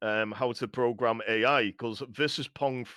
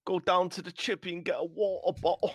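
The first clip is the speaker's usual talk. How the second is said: higher, hammed-up British banter; British accent; 6 semitones higher, faster than usual. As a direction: lay the British accent on thick, pitched up. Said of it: "So British".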